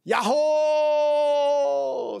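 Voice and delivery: long, high, loud holler; big sustained mountain yell; shouting yahoo. Retold or imitated. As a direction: call out from a hilltop, drawn out. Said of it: "소리를 질렀어요".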